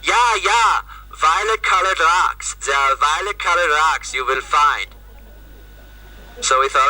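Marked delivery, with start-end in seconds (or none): 0.0-0.8 high-pitched, hammed-up German "ja, ja"; imitated German monk, pitched high; German accent. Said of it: "And the German guy goes". 1.1-2.5 put-on high, slow, drawn-out German accent; imitated German monk, slow and high. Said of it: "And the German guy goes". 2.6-4.9 imitated German monk's English; hammed-up thick German accent. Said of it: "And the German guy goes".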